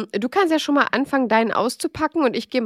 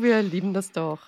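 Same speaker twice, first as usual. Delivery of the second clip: usual speaking voice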